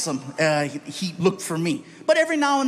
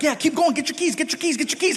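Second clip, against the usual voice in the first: higher, hurried go-ahead; mock security guard; 7 semitones higher, faster than usual. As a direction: wave him along, humoring him. Security guard waving him through.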